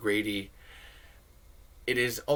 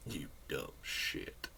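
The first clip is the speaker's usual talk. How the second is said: Tremors impression; 7 semitones lower, 11 dB quieter, much slower than usual. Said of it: "Tremors impressions".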